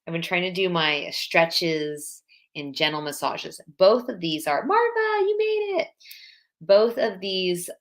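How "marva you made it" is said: much higher delighted welcome; greeting Marva joining the live, delighted; excited greeting. Real, not acted. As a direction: squeal hello to a friend.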